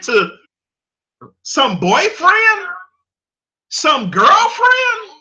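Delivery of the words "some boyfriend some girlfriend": high, slow and drawn out in disbelief; mocking disbelief, rising high; incredulous mock. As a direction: stretch each word up in mock outrage. Mocking rant to parents.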